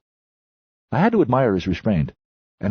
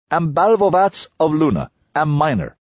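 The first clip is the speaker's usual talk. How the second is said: put-on broken English in a higher Lunar miner accent; 8 semitones higher, 4 dB louder than usual. A narrator's reading. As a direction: boast in a clipped foreign Lunar accent. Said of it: "He pronounced it like shuffled".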